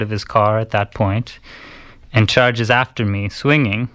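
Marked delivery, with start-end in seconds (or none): none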